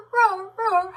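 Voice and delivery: high, loud puppy cries; unhappy puppy. Retold or imitated. Imitating her puppy crying upstairs, unhappy howls. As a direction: imitate a little pup howling in protest. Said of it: "She's like".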